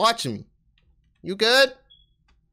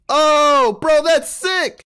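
slow, loud, higher hype yell; excited shout; 6 semitones higher, 6 dB louder, much slower than usual. Real, not acted. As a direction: shout it out, stoked. Excited at game move.

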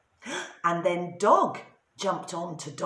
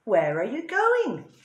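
animals asking; 5 semitones higher, 5 dB louder than usual. A narrator's reading. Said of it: "said the dog, the donkey, and the cat".

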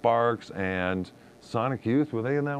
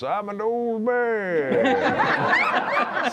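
put-on high, very slow old man voice; 11 semitones higher, much slower than usual. Shown as a demonstration. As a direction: draw it out like a creaky old man. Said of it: "Here's my old man voice".

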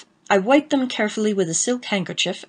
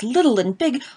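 plain reading voice

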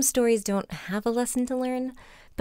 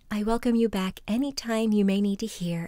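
whispering. Real, not acted. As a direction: whisper it gently. Whispered sign-off.

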